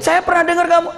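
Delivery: high-pitched mock surprise; imitating a taken-aback listener; startled. Startled listener recognises the speaker, high and surprised.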